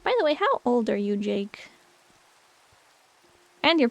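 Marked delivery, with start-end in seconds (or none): none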